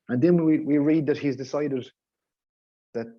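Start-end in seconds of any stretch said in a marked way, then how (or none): none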